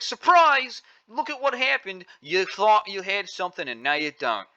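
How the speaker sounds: sheriff's gloating reenacted in a vague accent; hammed-up sheriff surprise, half New York half Aussie; imitating the sheriff's odd accent. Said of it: "I don't know what accent that was".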